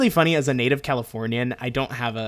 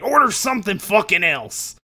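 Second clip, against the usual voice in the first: louder, higher angry retort; angry snap; 8 semitones higher, 5 dB louder than usual. Retold or imitated. Waiter snapping at a picky customer, angry. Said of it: "he gets angry".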